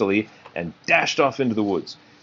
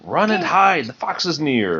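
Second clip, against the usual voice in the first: nervous chatter; 6 semitones higher than usual. Acted. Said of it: "loud, nervous chatter".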